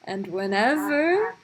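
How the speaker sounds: ordinary talk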